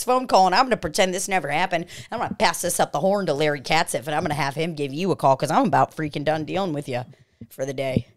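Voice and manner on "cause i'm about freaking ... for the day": usual speaking voice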